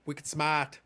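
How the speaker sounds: wicked smart, Boston style; Boston accent. Retold or imitated. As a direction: quote it with a light Boston accent. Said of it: "and I quote".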